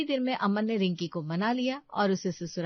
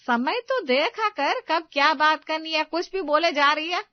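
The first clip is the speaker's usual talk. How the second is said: scolding; 8 semitones higher, 8 dB louder than usual. Acted. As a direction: snap at her to mind the timing. Mother-in-law scolding daughter-in-law.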